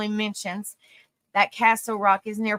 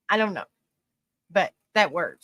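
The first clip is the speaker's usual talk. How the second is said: ordinary talk, nothing marked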